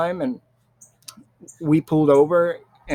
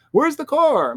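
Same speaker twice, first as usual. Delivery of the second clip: shrill demand; 12 semitones higher, slower than usual. Retold or imitated. Relative asks a second time about the car, rising alarm. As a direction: push the question up into a panicked cry. Family asking again, car missing.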